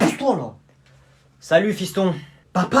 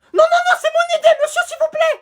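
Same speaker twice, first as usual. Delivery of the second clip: schoolboy begging to fight first on camera; shouting kid voice; 11 semitones higher than usual. Acted. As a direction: demand the spotlight like a child. Kid insists loudly.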